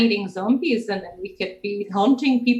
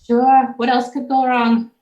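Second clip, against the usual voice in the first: sarcastic exasperation; 4 semitones higher, 7 dB louder than usual. Acted.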